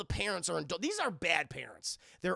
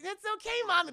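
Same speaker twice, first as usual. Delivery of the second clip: put-on high child's voice; imitating a daughter reassuring mom; little girl voice; 13 semitones higher, 4 dB louder, faster than usual. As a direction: soothe like a small girl. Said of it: "He's like".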